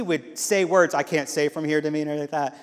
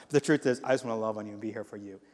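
ordinary talk, nothing marked

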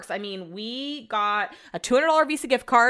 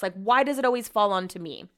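ordinary delivery

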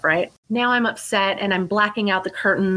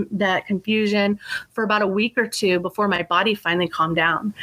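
ordinary delivery

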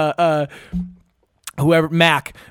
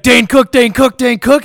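loud, high, frantic chanted yelling; imitated screaming; 8 semitones higher, 7 dB louder than usual. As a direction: yell it like someone raving in a panic. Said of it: "he's, like, screaming and, like, yelling".

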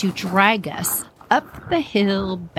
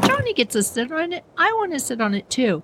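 put-on high, quick childish protest; whiny sibling complaint; 6 semitones higher, faster than usual. Child to Dad about brother's turn, high quick whining. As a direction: complain fast and high, like a jealous kid. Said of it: "I said".

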